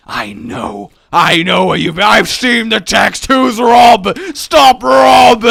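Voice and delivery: hammed-up tearful shouting, building; jealous accusation. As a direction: wail it like a betrayed lover in a soap. Mock accusation of cheating partner.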